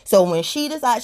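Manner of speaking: normal talking voice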